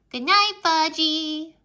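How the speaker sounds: put-on high, slow toddler goodnight. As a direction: sing it out like a toddler. Brother's voice.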